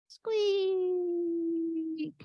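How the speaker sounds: very high, soft whispered squeak; a tiny breathy creature squeak. Acted. Squeaker replies to Honey, soft high whisper. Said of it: "pretend I can act".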